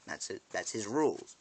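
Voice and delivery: normal talking voice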